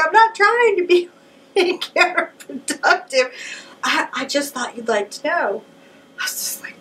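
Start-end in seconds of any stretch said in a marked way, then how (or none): 0.0-5.6 high, sing-song mock mom; teasing mom voice. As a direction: needle her daughter sweetly, higher.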